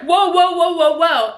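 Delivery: high, loud string of whoas; acted alarm, trying to halt an outburst; alarmed protest. Nanny to the screaming child, alarmed protest.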